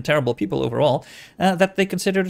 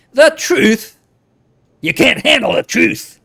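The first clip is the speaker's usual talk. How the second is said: loud, high, hammed-up outburst; mock outraged shout; 6 semitones higher, 7 dB louder than usual. Reader mocking talk of the truth, hammed-up shout.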